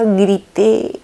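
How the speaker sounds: normal talking voice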